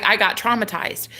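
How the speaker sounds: usual speaking voice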